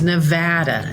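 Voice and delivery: "Nevada" with middle A like "ant"; short A as in ant. Shown as a demonstration. Senator corrects the host, "Nevada" with short A. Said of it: "the little ant".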